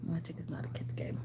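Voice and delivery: soft breathy whisper. Real, not acted. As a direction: whisper it like a secret aside. Whispered repeat of own line.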